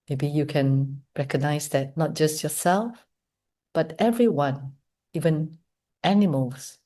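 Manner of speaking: soft, unhurried meditation voice with long pauses; hushed, measured invitation to reflect; guided meditation. Real, not acted. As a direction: guide them gently inward, leaving space between phrases. Leads guided meditation after the talk.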